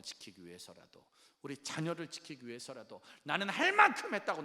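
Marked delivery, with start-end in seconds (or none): 3.2-4.4 indignant outburst. Acted. Voicing defiant self-justification.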